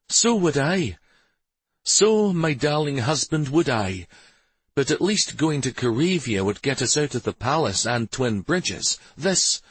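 high-pitched character voice of a wife; playful, eager wife. A narrator's reading. Wife agreeing with her darling husband, playful and eager. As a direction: tease him fondly, then plead for the trip.

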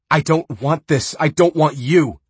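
loud high denial arguing with herself; torn high protest. Acted. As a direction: protest too hard, high. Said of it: "she said".

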